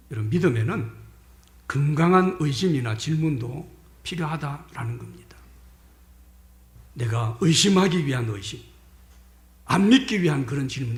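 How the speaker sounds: ordinary talk, nothing marked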